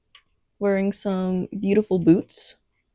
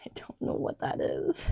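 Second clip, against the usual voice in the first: a puzzled whisper to herself; 9 dB quieter than usual. Real, not acted. Looking at her old sketch, whispering to herself in puzzlement. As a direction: breathe it out, barely voiced, wondering.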